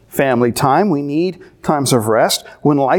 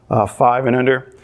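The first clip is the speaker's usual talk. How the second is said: ordinary talk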